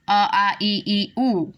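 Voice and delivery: each vowel "अ, आ, ई, ई, उ" said separately and clearly; vowel recitation. Shown as a demonstration. Teacher to students, reciting the vowels apart clearly. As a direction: recite the vowels one by one, like a drill. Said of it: "ये मैं बोल रही हूँ ताकि थोड़ा सा समझ में आए".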